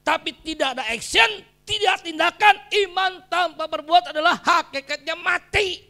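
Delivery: loud, high-pitched preaching shout; khotbah berapi-api. Real, not acted. Preaches at full force. (berkhotbah lantang dengan nada tinggi)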